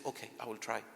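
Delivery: put-on a small hesitant child agreeing; child's reply.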